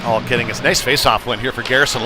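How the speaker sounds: ordinary delivery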